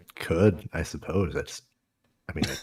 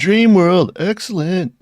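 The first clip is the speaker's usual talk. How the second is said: announcer voice; 9 semitones higher, 8 dB louder, slower than usual. Pretend. Hyped game announcer echo.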